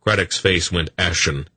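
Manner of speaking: plain reading voice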